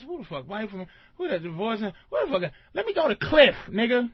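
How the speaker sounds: usual speaking voice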